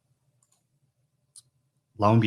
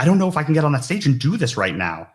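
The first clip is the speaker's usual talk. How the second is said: much higher and louder, fast, voice straining; retelling backstage panic, higher, louder and rushed; panicked; 8 semitones higher, 8 dB louder, faster than usual. Retold or imitated. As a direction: blurt it out in a rising panic. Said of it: "I was like".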